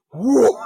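high, loud whooshing "whoo"; comic falling-object sound; imitated whoosh. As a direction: make the noise of something heavy swooping down. Imitating the TV dropping in.

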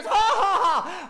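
loud, high mock guffaw; mocking laugh. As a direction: belt out a jeering fake laugh. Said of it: "his brothers laughed at him".

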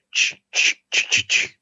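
percussive voiceless "ch" bursts; imitated sound effects. Describing radio baseball sound effects, imitated "ch" noises. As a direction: make rhythmic ballpark noises with "ch". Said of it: "all the sound effects".

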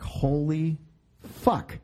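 ordinary talk, nothing marked

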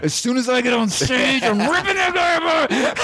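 high-pitched comic rant; hammed-up threat breaking into laughter; mock threat. Acted threat against talking audience member.

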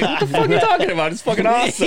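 high, fast protest through laughter; laughing, argue back in disbelief; incredulous retort. Retold or imitated. Acted retort to disappointed friend.

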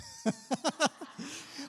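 high-pitched burst of laughter; amused laugh breaking out. Real, not acted. Pastor teasing the seniors about lunch, high amused laugh.

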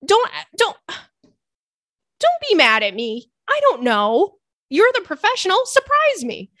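high, loud whiny plea; mock pleading. To the barista, high mock-pleading whine. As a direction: beg the barista to just pick for her.

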